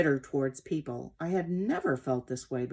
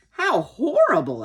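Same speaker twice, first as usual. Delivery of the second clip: high, loud horrified exclamation; 8 semitones higher, 9 dB louder than usual. A narrator's reading. Young horse hears of the cut tail, horrified. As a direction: react aghast, pitched well up.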